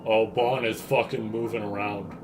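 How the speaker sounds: ordinary talk, nothing marked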